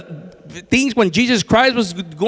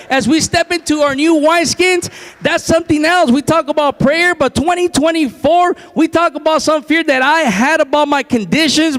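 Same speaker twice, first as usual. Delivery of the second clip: high-pitched, driving sermon delivery; raised, insistent pulpit voice; fervent preaching; 6 semitones higher than usual. Real, not acted. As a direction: preach it out to the whole church.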